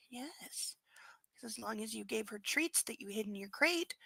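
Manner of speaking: soft cooing to a pet; pet talk. Real, not acted. Owner recalling snuggles to the dog, soft cooing. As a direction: murmur it tenderly to a sleepy dog.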